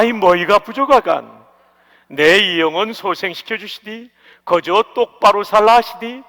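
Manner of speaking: loud, lively Pyongan-accented psalm; 평안도 사투리로 힘차게; Pyongan dialect reading. Shown as a demonstration. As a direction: read it with a bold Pyongan twang. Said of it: "평안도 버전입니다".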